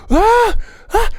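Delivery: high, loud mock scream; sharp high cry of alarm; panicked yell. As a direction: yelp as you slide off the edge. Sliding off the iceberg.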